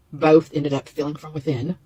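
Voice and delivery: ordinary talk, nothing marked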